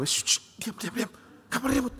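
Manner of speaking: urgent whispered shushing; acted quiet scolding in public; hushing parent. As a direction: hush a child under your breath. Embarrassed parent hushing child in public.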